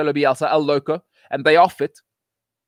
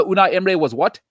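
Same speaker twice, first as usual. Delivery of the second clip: ordinary delivery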